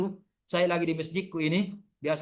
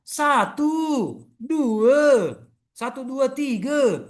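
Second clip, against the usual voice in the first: satu, dua, tiga counted in a Malaysian accent, higher and louder; Malaysian-style satu dua tiga, punchy; 6 semitones higher, 4 dB louder, much slower than usual. Shown as a demonstration. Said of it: "Orang Malaysia mengatakan apa?".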